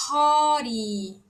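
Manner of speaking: hori with an aspirated first R; Korean pronunciation. Shown as a demonstration. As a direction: breathe out the h in hori. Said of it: "hori tem dois tipos de R". (hori com o primeiro R soprado)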